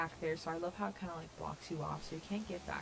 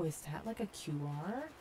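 usual speaking voice

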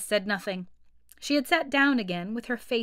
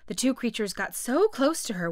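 usual speaking voice

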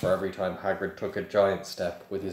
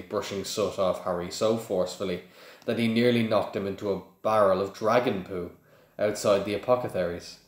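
plain reading voice

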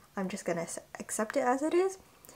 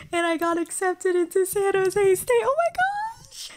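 excited; 8 semitones higher, 6 dB louder than usual. Real, not acted. Announcing acceptance.

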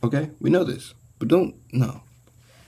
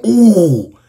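loud, high "ooh" sliding down; mimicked "ooh"; 9 semitones higher, 6 dB louder than usual. Repeating his reaction to the cooking, loud mock ooh. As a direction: act out his impressed hoot, loudly. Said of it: "Yeah, he going".

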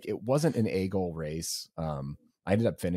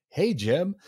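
bright, much higher call of hey Jim; cheery greeting; 11 semitones higher, 5 dB louder than usual. Retold or imitated. Runner breezily greeting rivals as he passes, high cheery call. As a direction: toss out a breezy hello while passing.

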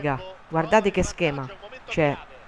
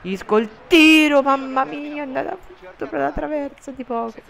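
excited; 9 semitones higher, 6 dB louder than usual. Real, not acted. Near miss commentary.

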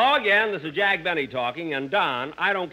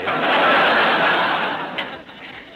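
louder unvoiced laughter; 7 dB louder than usual. Real, not acted. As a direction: keep laughing loudly. Keeps laughing.

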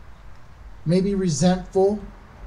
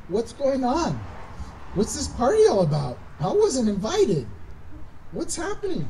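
puzzled questioning; 5 semitones higher than usual. Retold or imitated. Older brother asking a servant about the party, bewildered. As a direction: ask as if left out, pitch lifting.

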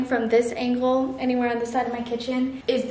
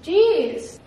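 shocked; 5 semitones higher, 6 dB louder, much slower than usual. Real, not acted. Reacting to bleeding finger.

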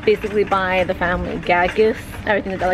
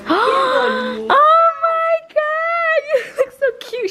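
high, fast, near-tearful gushing; much slower than usual. Real, not acted. An aunt gushing high over a little girl's glasses. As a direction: melt over how cute she is.

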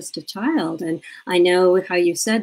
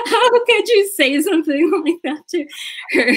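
high-pitched imitation of an indignant mom, laughing; hammed-up, high scolding; mock scolding; 11 semitones higher than usual. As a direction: play-act telling someone off through laughter. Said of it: "chewing out the teacher".

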